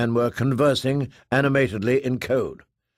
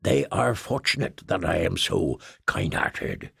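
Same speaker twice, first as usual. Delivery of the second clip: lower, slow, softer aside; self-pitying grumble; 5 semitones lower, 3 dB quieter than usual. Acted. Tuff complaining to the kitten, self-pitying.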